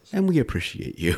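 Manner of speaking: earnest public radio announcer voice; sincere pledge-drive thank-you; public radio host. Acted. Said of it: "like a public radio announcer".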